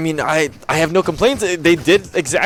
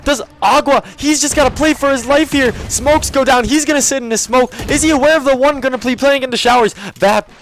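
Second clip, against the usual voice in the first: high, loud hype play-by-play; esports caster; 7 semitones higher, 4 dB louder than usual. Real, not acted. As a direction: call every kill as it lands. Live play-by-play cast.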